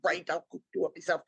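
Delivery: sulky sarcastic giving in; mother sulking at daughter, mock pouty; pouty sarcasm. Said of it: "I'm pouty".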